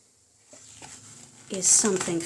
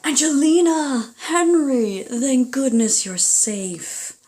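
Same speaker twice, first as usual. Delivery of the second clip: loud, higher calls, then slow relief; calling out relieved; 6 semitones higher, 9 dB louder than usual. Acted. Uncle Louie finding the lost mouselings, loud relieved call. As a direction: shout their names across the woods, then sigh of relief.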